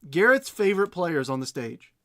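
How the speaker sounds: loud mock-grateful sarcasm; sarcastic. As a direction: boom it out with fake gratitude. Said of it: "I can't even say it with a straight face".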